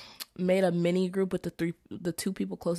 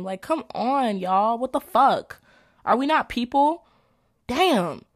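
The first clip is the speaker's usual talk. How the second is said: louder, higher, fed-up outburst; exasperated rant; 4 semitones higher, 6 dB louder than usual. Real, not acted. Venting to viewers about silent groupmates, exasperated rant. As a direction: vent at the whole class. Ranting at classmates.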